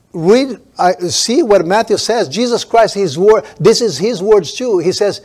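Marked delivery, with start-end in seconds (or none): none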